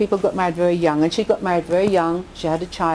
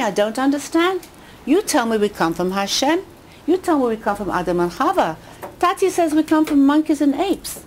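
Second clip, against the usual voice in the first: put-on confused child; 6 semitones higher than usual. Confused girl back to mom.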